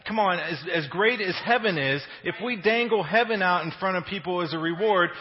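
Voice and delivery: loud, impassioned sermon; full-force preaching. Acted. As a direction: drive the point home. Preaching climax to congregation.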